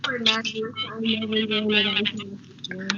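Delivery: muffled phone voice; words muffled as if through a hand. Real, not acted. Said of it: "Your phone sound kinda like it's, uh, muffled".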